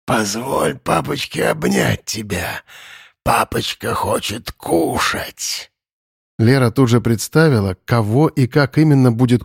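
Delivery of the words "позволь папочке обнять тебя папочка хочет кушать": softer, slow menacing croon; hissing monster. Acted. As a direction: croon like a hungry predator. Monster luring girl to eat.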